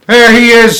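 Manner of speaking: loud high cry from the crowd; excited shout. Acted. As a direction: yell it out as you spot him. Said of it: "somebody shouted".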